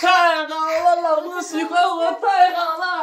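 put-on high, slow childlike delight; excited. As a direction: squeal with happy relief. Childlike joy at being loved.

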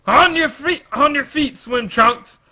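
Frankie shouting. Acted. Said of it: "Frankie says".

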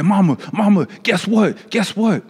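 put-on high, eager kids bursting with news; excited children. Siblings running in to tell Mama, excited.

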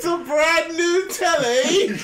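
high, loud, drawn-out British exclamation; mock British accent. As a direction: laugh through an overdone British accent. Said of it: "I feel so British".

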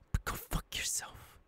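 sudden whispered taunt to the captive; menacing whisper. Acted. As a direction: drop to a breathy-free hiss of a whisper. Villain taunting a bound captive.

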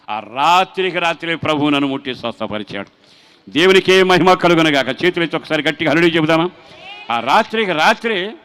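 loud, triumphant preaching; preaching at full force. Acted. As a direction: proclaim it to the whole hall. (పూర్తి శక్తితో బోధ)

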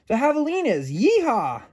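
high, loud yeehaw; excited shout. A narrator's reading. The cookie spots the javelinas, high excited shout. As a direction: whoop it out. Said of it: "Gingerbread shouted".

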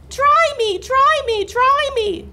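very high, insistent squeaky plea; baby chick cry. A narrator's reading. Soggy chick to the cat, pleading high cry. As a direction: demand it over and over as a tiny chick. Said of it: "cried the soggy baby chick".

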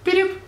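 high squeaky beep sounds; mock beep noise. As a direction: chirp like a gadget. Mimicking detonator beep.

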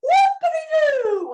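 very high, loud whooping cry; high excited whoop. Acted.

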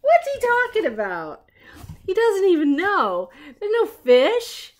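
high, loud incredulous aside; mock disbelief. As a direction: exclaim at the silly detective. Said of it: "reader reacts with mock disbelief".

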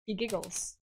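ordinary delivery

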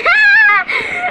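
high, loud burst of giggling; helpless laugh. Real, not acted. Alone playing a Roblox story, bursting into loud laughter. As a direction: crack up at nothing, can't stop. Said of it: "I don't know why I'm laughing".

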